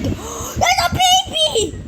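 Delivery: very high, drawn-out shriek; gasp, then shriek it high; shocked scream. Pretend. Player suddenly spotting a baby, shocked scream.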